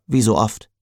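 ordinary delivery

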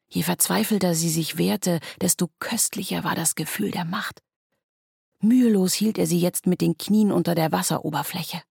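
ordinary delivery, nothing marked

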